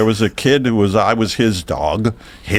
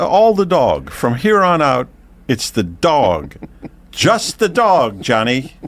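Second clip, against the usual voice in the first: higher, emphatic, spaced-out correction; exasperated insistence; 6 semitones higher, slower than usual. Pretend. Storyteller to interrupting Johnny about who is talking, exasperated. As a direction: lay it down slowly, word by word.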